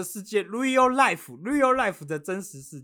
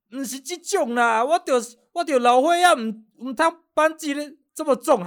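put-on higher, slow old man with a Taiwanese-Mandarin lilt; old Taiwanese shopkeeper; 7 semitones higher, 4 dB louder than usual. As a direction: imitate the grumbling old boss. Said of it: "他讲台语啊". (模仿台湾腔老头抱怨)

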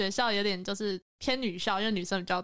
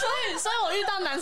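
laughing; 11 semitones higher than usual. Real, not acted. Excited retelling, laughing loudly.

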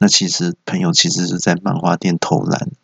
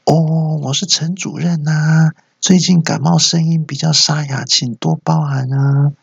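high, drawn-out phony client; put-on, stretched high voice; fake client voice; 7 semitones higher, slower than usual. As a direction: disguise your voice as a client greeting a manager. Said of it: "没有听过这么恶心的声音耶".

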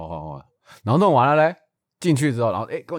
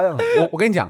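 ordinary delivery

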